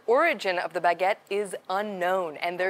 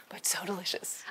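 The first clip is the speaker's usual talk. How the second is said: whispering; 11 dB quieter than usual. Real, not acted. Praising dish in aside.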